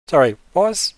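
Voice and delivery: high, loud, taken-aback character voice; NPC in character. Acted. NPC reacts to the player's news, high surprised voice.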